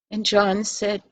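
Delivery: usual speaking voice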